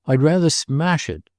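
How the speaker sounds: mildly defiant outburst; crying out. A narrator's reading. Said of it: "cried Roderick".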